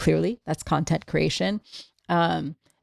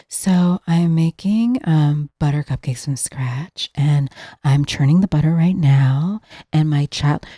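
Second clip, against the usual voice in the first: whispery influencer voice. Retold or imitated. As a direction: imitate her hushed cooking narration, lightly. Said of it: "she kind of talks in a whisper".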